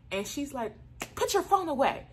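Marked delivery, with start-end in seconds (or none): 1.2-2.1 high-pitched mock command; high, scolding principal impression; retold principal's order. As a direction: imitate a principal giving a sudden order.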